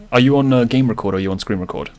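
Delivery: ordinary delivery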